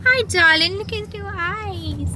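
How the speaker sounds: very high sweet puppy talk. Real, not acted. Talking to puppy.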